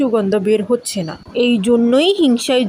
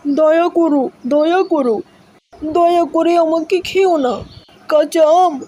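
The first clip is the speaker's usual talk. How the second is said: higher, frightened begging; pleading for mercy; 5 semitones higher than usual. Acted. Ripe mango to the hungry cat, pleading in fear. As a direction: beg the hungry cat not to eat you.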